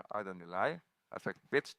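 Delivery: ordinary talk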